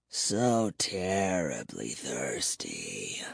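deep, hushed, drawn-out craving; muttering. A narrator's reading. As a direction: mutter it slowly, sinking to a low murmur. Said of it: "he kept muttering".

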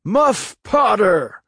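high, slow, loud shout of the name; crowd shout. Acted. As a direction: call the name out. Crowd names the suspect.

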